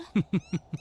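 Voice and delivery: light amused laugh. Acted. Amused by girlfriend's question.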